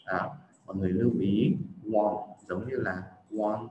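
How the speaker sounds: ordinary talk, nothing marked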